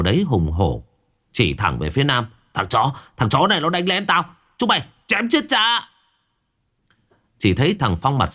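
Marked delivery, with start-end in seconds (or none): 2.5-5.9 knocked-out thug wakes and yells to have Nam killed; furious thug yell. Acted. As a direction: scream for his men to kill. Beaten thug rages at men.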